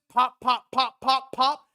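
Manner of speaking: popping exhaust noises, punchy; exhaust pops. Retold or imitated. Storyteller imitates the Corvette downshifting, popping. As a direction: mimic a car downshifting.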